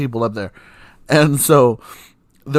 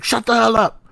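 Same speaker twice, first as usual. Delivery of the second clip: much higher, sharp mocking retort; mock snapping mom; 10 semitones higher than usual. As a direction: snap it like an irritated mother. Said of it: "And she's like".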